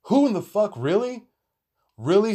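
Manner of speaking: high-pitched, incredulous questioning. Real, not acted. Friend recalling the clothes swap, incredulous. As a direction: pitch it up in sheer disbelief. Incredulous at their ridiculous outfits.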